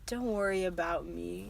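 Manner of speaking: low, very soft brave murmur; Luke from Star Wars. Acted. Wounded boy reassures the girl, low soft murmur. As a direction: play the hurt hero, hushed and low. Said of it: "I just sounded like Luke from Star Wars".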